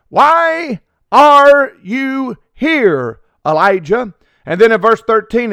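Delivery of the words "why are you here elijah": very slow, higher, drawn-out divine question; poignant question from the Lord. Retold or imitated. The Lord asking Elijah, very slow and high. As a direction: stretch each word as God calling out. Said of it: "the Lord asked him, uh, this poignant question".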